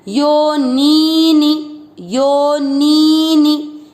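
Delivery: यो नी नी with the first नी drawn out long and the second नी short, chanted slowly; long then short नी. Shown as a demonstration. Teacher showing the class: first नी long, second नी short. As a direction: stretch the first नी, clip the second, slow recitation. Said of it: "कैसे पढ़ना है?".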